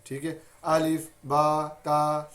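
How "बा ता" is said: बा, ता dragged slowly; wrong slow letters. Shown as a demonstration. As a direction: show the mistaken drawn-out reading. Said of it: "ये गलत है".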